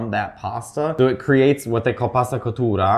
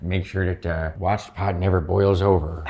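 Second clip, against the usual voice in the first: slow imitation of an Irish uncle's accent; 3 semitones lower than usual. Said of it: "And he's Irish".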